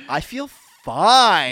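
slow, dazed. Retold or imitated. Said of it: "I was like".

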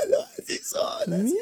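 high, crying whine, pretend caught out; mock whimper. Boyfriend caught with odd contact names, pretend whining.

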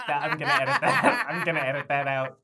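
laughing while talking. Real, not acted. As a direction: giggle through the words. Laughing at abandoned bit.

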